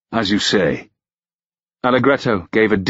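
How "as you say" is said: understated reluctant agreement; concede, a touch unwillingly; grudging assent. A narrator's reading. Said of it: "he assented grudgingly".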